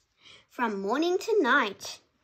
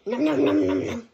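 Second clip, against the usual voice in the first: munching noises; 6 dB louder, faster than usual. Pretend. Acting out alpaca eating.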